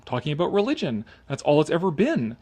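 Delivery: ordinary delivery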